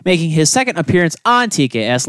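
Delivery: ordinary delivery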